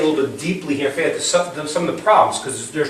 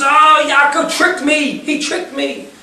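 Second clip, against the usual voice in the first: high, drawn-out mock wail; retold scream; 9 semitones higher than usual. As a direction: imitate a betrayed brother crying out, without full volume. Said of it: "he starts flipping out, and he screams".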